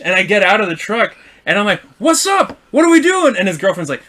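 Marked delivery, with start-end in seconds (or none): 2.0-3.4 high, loud confrontational call; retold fight challenge. Driver stepping out of the truck to fight, retold loudly. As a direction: act out inviting a fight.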